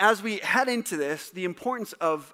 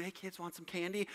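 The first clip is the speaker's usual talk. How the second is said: soft, hushed mock lure; quiet, sly offer, only half acted; stranger luring kids; 12 dB quieter than usual. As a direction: lightly play a stranger coaxing children. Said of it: "that you're a stranger".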